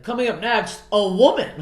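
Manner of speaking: loud, high comedy-show introduction; mock announcer. Comic mimicking hosts introducing a woman, mock announcer. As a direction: announce the next act in mock amazement. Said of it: "comics be like".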